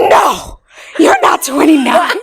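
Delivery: loud, high, emphatic denial; with conviction. Retold or imitated. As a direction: insist in mock disbelief, laughter creeping in. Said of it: "Like, with conviction".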